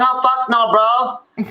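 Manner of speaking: high, frightened refusal; girl refusing. Retold or imitated. Girl refusing the monster, high panicked. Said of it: "And she's like".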